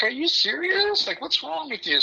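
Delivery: stunned outrage at his mom; incredulous. Retold or imitated. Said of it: "And so like".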